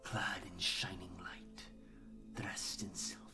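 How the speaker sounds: slow, whispered creature voice; reverent hive servant. Acted. Creature describes its master to the player, whispered. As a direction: whisper of the master in eerie awe.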